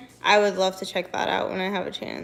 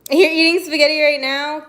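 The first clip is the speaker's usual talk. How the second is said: high, loud disbelieving question; surprised; 6 semitones higher, 10 dB louder than usual. Real, not acted. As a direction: ask in sudden disbelief. Surprised at a viewer eating.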